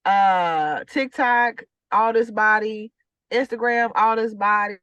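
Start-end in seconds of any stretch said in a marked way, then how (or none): none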